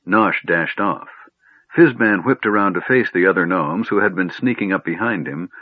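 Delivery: plain reading voice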